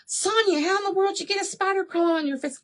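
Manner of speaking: high, quick, incredulous mom voice; imitating mom. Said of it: "And she's like".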